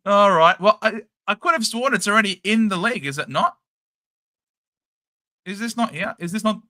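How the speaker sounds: ordinary talk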